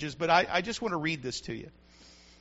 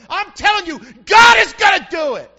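preaching shout; 9 semitones higher, 13 dB louder than usual. Real, not acted. Preacher shouting to his church, full force. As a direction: shout it out, much louder.

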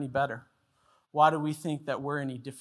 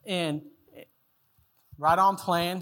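ordinary delivery

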